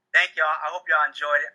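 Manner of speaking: ordinary talk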